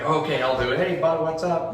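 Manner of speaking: light, imitated Canadian accent; playful Canadian accent impression. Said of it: "it lands on Canadian".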